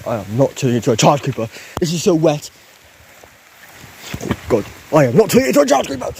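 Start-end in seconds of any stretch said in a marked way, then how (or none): 4.9-6.1 fast, high-pitched outburst; rising, hurried protest; agitated protest. Real, not acted. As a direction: refuse it rapidly, voice climbing high. Same refusal escalated in protest.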